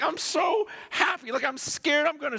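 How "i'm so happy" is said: mock scream. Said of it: "Sometimes I'll just scream".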